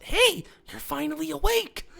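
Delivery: high-pitched cartoon pony greeting; squeaky pony version of Skyrim. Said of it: "it's Skyrim pony mods".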